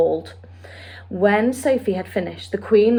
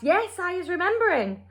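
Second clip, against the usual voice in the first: higher, eager remembering; bursting to tell; excited giant; 10 semitones higher than usual. A narrator's reading. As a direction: BFG proud he remembers. BFG excited.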